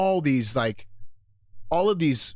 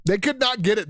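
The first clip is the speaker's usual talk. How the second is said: ordinary talk, nothing marked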